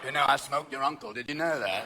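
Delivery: low rock-star impersonation; low-pitched mock confession. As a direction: say it low, as a burnt-out rocker. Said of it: "[name] will go".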